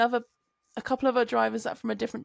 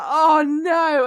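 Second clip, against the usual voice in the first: louder, drawn-out oh no; mock dread groan; 6 semitones higher, 10 dB louder than usual. [name] dreading the reveal, loud groan. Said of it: "I was like".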